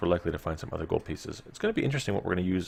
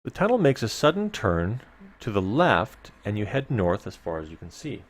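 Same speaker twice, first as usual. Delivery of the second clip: measured gamebook reading to the listeners; story narration; 5 dB louder than usual. A narrator's reading. Host reading gamebook text to listeners, storyteller narration. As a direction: read the dungeon text like a storyteller. Reads gamebook passage aloud.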